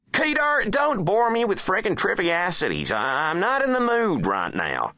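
high, loud presidential rebuke; irritated president. Acted. President rebuking the Secretary of State, high and irritated. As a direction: shut them down in a high, testy voice.

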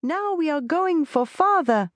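put-on high, joyful child calling out; child's shout. A narrator's reading. Little Tony cheering to his mother, high and joyful. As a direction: cheer it like a happy little boy.

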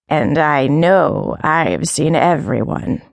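put-on low, slow, scornful old she-cat; deep old cat voice. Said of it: "The voice was deep".

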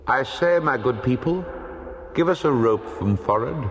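calling out. Acted. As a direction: call up to the ship from a boat below. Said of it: "a voice calling out".

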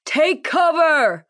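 slow, drawn-out warning shout, pitched high. Acted. Lieutenant warning staff as the helicopter rises, shouting. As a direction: bellow the warning over the rotor noise. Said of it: "Lieutenant Lopez shouting".

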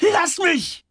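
very high shriek; piercing cry to be left alone; full-throated scream. A narrator's reading. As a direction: scream it at the top of your lungs. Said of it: "schrie George aus vollem Hals".

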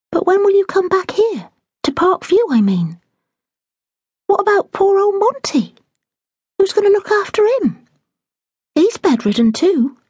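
a hint of a curious young girl; young maid pressing the housekeeper for news; eager young maid. Daisy voiced in reading.

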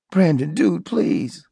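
high, desperate plea for his life; beg, voice rising and fading; pleading. Acted. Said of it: "began pleading for his life".

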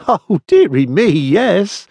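high, amused character voice; light, high-pitched delivery as if amused; laughing. A narrator's reading. Anderson happily accepts the clerk's sum, laughing. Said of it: "said Anderson, laughing".

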